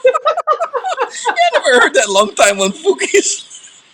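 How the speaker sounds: high, slow laughing speech; laughing hard. Real, not acted. Hearing the old word fuki, laughing high. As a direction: squeal through laughter.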